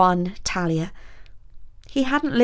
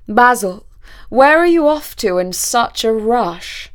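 loud, drawn-out challenge from the Count; the Count calling out in a big, slow voice; imperious Count; 4 semitones higher, 7 dB louder, slower than usual. A narrator's reading. Count stops the hurrying student, loud and questioning.

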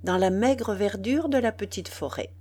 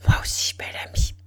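put-on quick breathy whisper of a girl in love; murmured; much faster than usual. A narrator's reading. Girl answering her suitor's declaration in a quick hushed whisper. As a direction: whisper it fast, unvoiced, almost secret. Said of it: "Elle murmura".

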